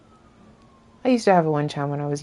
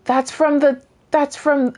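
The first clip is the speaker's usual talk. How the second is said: higher-pitched startled realisation, words cut off; 8 semitones higher, 3 dB louder, slower than usual. Real, not acted. Player spots something unexpected in the game, startled. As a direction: blurt it higher, breaking off mid-thought.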